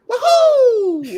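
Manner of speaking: very high, loud cartoon whoop; Mario impression. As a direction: squeal it like Mario jumping. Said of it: "expecting him to be like".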